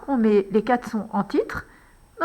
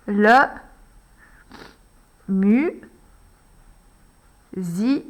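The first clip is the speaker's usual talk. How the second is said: "mu-" and "zi-" each held apart with long gaps; syllable dictation; 2 semitones lower, much slower than usual. Shown as a demonstration. Teacher rewriting the word, slow syllables. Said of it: "On peut le réécrire encore une fois".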